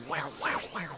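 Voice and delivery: ordinary talk, nothing marked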